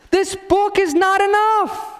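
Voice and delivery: very high, strained mock yell; obnoxious, high-pitched imitated shouting. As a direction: yell the whole line at top pitch. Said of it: "then they say it louder".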